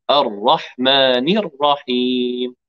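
slow الرحمن الرحيم with one tap of r; single tap r. Shown as a demonstration. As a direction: tap the r only once, slowly. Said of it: "راء واحدة".

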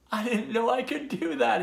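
high-pitched, amazed storybook voice; relieved character's happy exclamation; delighted. A narrator's reading. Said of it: "he laughed".

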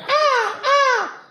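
high falsetto moans, hammed-up; mock moaning. Comedian imitating the porn actress, mock falsetto moans. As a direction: mimic her moaning in a squeaky falsetto. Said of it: "She's like".

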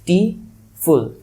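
টি ফুল slowly, as two separate beats; syllable demonstration. Shown as a demonstration. Teacher finishing the word for students, টি ফুল syllable by syllable. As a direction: split it clearly: টি, then ফুল. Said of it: "তুমি উচ্চারণ করে দেখো".

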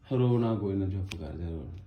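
real hushed comforting aside. Real, not acted. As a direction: drop to a low, very soft murmur. Tells crying relative to hush.

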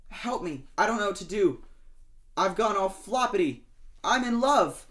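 flustered pleading rising to a giddy declaration; lovesick shark. Acted. Shark begging Lobster for help, rising lovesick voice.

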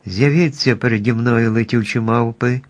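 ordinary delivery

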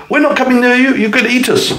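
very high, frightened squeal; the animals shriek as they flee; scared animals. A narrator's reading. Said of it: "They would say".

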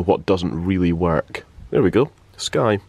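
normal talking voice

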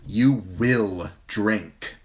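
slow, icy command; queen ordering the poisoner, ice cold. A narrator's reading. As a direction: freeze him with each word. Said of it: "cold as ice".

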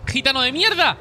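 loud, high furious yell; angry shout. Real, not acted. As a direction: yell the insult in rage. Insulta al enemigo. (grito de rabia)

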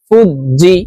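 ordinary talk, nothing marked

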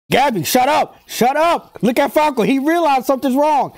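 high-pitched, exasperated yelling at the screen; worked-up viewer talking back; worked-up heckling. Real, not acted. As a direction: heckle the character, pitch jumping up.

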